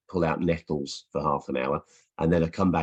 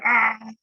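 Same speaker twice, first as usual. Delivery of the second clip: sudden much higher, louder imitated scream; daughter's tackle scream; 5 dB louder than usual. Mum imitating her daughter screaming while tackling, high loud yell. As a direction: shriek like a seven-year-old charging in to tackle. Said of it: "she screams as she's doing it".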